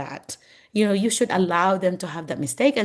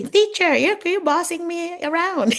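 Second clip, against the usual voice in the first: complaining student; 12 semitones higher, 4 dB louder than usual. Retold or imitated. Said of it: "They would say".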